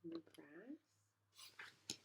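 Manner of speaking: put-on hushed whisper to the kids; whispered aside. A narrator's reading. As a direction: share it like a little secret. Pointing to picture, secretive.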